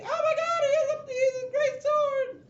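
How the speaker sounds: very high, loud shrieking; panicked scream. Acted. Game master voices panic at half orc's sword, shrieking. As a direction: squeal it out in mock terror.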